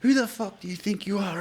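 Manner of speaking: high, sharp angry demand; menacing confrontation. Retold or imitated. Comedian standing over heckler, high angry threat. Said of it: "he's going".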